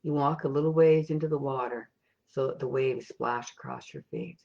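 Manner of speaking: soft, unhurried meditation voice with long pauses; guided meditation. Acted. Meditation leader guiding listeners on an imagined ocean walk, soft and calm. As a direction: lead listeners gently inward, leaving space after each thought.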